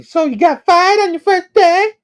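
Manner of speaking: very high squealed question; hammed-up drunk woman's falsetto; Bree. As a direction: squeal it like the tipsy weird lady.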